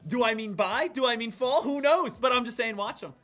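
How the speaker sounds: playful sarcastic rhetorical questions; mocking shrug. Real, not acted. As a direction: poke fun at the hedge. Mocking Cramer's hedged wording.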